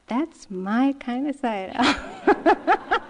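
slow words through real laughter; laughing. Real, not acted. Speaker leaving the monk's room, laughing slowly. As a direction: laugh it out, delighted.

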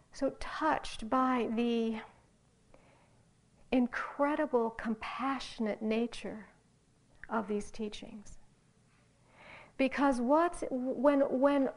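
ordinary delivery

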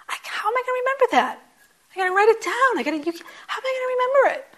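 high, fast, loud mock panic; panicked younger self. Teacher imitating her anxious past self, high and fast. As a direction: ham up the flustered beginner.

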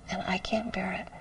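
broken hushed whisper; grieving whisper. Retold or imitated. Quoting a sobbing student, whispered. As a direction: let it barely come out through grief.

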